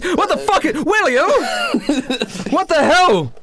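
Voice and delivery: shocked Trevor; startled outrage. Acted. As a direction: caught with his trousers down. Trevor summoned mid toilet.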